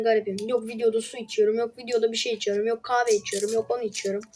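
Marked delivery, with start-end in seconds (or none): none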